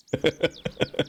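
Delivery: high little laugh at his own joke; short laugh. Real, not acted. Laughing at his own joke about outsinging the band.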